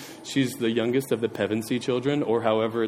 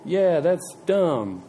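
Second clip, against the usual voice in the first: slow, mock-casual Jesus voice; 3 semitones higher, much slower than usual. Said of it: "I thought you were gonna be like".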